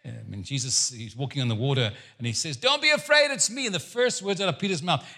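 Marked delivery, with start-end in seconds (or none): 2.6-3.7 high, loud call. Acted. Jesus calls to disciples from the water, calling out. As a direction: call out with a high-pitched reassurance.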